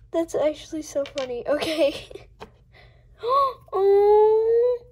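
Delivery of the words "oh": real delighted gasp and shriek; excited squeal. Real, not acted. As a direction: gasp then a high, loud squealed oh. Unboxing a cute toy.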